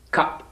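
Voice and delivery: isolated, short "cup"; model word. Shown as a demonstration. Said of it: "And then say".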